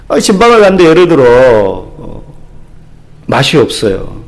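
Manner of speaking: ordinary talk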